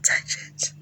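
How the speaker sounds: hushed insistent whisper; softly insisting whisper; repeated whispered command. Retold or imitated. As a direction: coax her in a whisper, over and over. His whispered command again.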